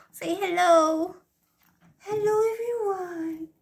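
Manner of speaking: high pet voice greeting the viewers; sing-song greeting. Pretend. Voicing the cat's greeting.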